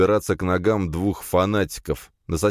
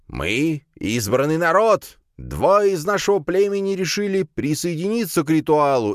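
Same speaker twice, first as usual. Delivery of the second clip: cult leader preaching; 10 semitones higher than usual. A narrator's reading. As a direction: proclaim to the crowd like a fanatic prophet. (протяжная высокая проповедь главаря секты)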